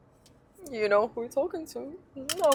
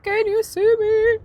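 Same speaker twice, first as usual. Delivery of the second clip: loud call; 12 semitones higher, 8 dB louder than usual. Retold or imitated.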